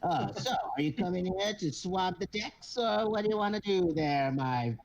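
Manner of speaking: pompous kobold captain. Acted. Kobold captain boasting to the crew, high and pompous. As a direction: puff up like a tiny captain in a big hat.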